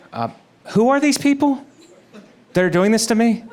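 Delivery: squeaky high outraged question; high-pitched indignant Jesus. Retold or imitated. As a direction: shoot up high in disbelief.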